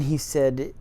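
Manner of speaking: ordinary delivery, nothing marked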